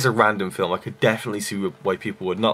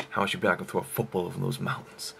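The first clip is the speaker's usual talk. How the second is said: lightly voiced film uncle, softer than usual; quoting the movie uncle; 7 dB quieter than usual. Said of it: "Napoleon Dynamite's uncle comes in".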